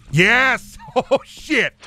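high, loud triumphant shout; excited cheer. Real, not acted. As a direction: cheer a close escape. Narrow survival cheer.

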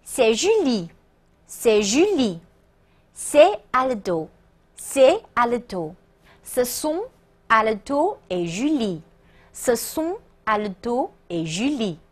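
slow, evenly spaced French model sentences; careful read-along. Shown as a demonstration. Teacher leads the class in reading once through, slow and careful. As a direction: read each phrase slowly, pausing for repetition. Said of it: "大家跟我一起读一遍啊".

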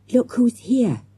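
ordinary delivery

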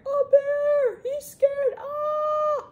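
very high, loud acted panic; frightened screaming, held high. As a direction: shriek in storybook fright. Screamed Eddie.

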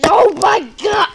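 sudden frightened shout; startled yell. Real, not acted. Jump scare in video.

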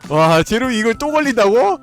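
high loud roulette hype; excited. Real, not acted. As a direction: call out the result thrilled. (흥분한 외침)

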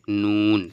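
নুন said loud, tongue tip against the palate; Arabic letter nun. Shown as a demonstration. Teacher modelling নুন for students, loud and clear. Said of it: "try করুন".

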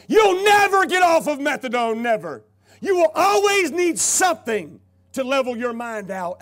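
loud, high, hammering each word; doctor's harsh verdict. Retold or imitated. Doctor shouting at the addict he won't recover. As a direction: slam the verdict down on him.

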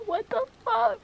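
high, loud shocked cry; shocked outburst. Real, not acted. Reacting to a prince ripped away, shocked outburst. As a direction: blurt it out in real disbelief.

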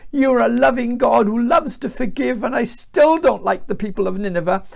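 acted bitter whinge; groaning complaint. A narrator's reading. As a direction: grumble resentfully at God. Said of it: "he groaned".

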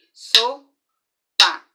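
"Sopa" as "so-pa", loud; syllable-clapped. Shown as a demonstration. Teacher counting syllables aloud, loud. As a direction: clap out "Sopa" in two beats. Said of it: "Tem alguma coisa parecida aí".

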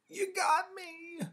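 high, drawn-out mock death cry; hammed-up groan of a child pretending to be shot; play-die loudly, stretching the words; theatrical groaning call. A narrator's reading.

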